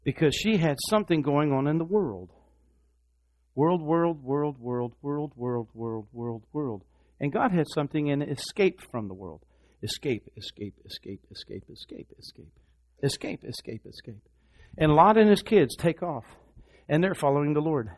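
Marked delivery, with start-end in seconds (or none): 3.5-6.8 repeated word fading from soft to near silence; fading repetition. Acted. Preacher echoing the world to the congregation, fading away. 9.8-14.2 "escape" repeated, sinking from soft to a whisper; fading to a whisper. Acted. Preacher urging escape, voice fading into a whisper.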